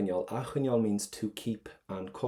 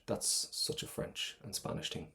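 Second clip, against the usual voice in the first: ordinary talk, nothing marked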